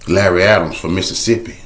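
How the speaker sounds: usual speaking voice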